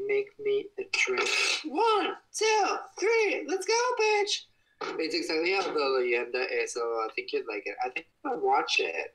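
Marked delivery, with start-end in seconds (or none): 1.7-4.4 hyped up. Acted. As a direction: pump everyone up, shouting. Hyped countdown before a drink.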